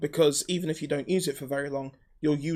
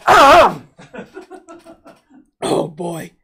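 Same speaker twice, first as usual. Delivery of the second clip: rough, raspy smoker's voice; smoker's rasp; 10 semitones higher, 16 dB louder, much slower than usual. Real, not acted. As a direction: croak it out like a heavy smoker. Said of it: "I just sound like I've been smoking twenty a day".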